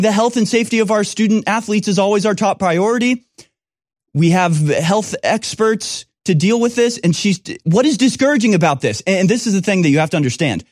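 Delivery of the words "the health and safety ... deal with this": mock official statement. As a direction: read it like it's absurdly harmless.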